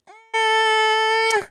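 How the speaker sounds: high, drawn-out doubtful hum; slow suspicious mm before the question; skeptical. Retold or imitated. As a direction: squint at it like it's a scam. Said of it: "I'm just like".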